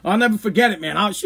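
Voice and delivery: usual speaking voice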